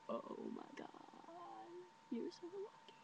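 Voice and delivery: very soft, swooning envy; envious whisper. Retold or imitated. To the friend in his math class, envious whisper. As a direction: breathe it out, barely audible.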